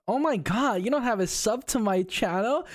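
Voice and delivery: a haughty ojou-sama sneering at a non-subscriber; sarcastic ojou-sama. Pretend. Mocking fans without a sub as a haughty ojou-sama, high and sarcastic. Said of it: "I can do it very sarcastically".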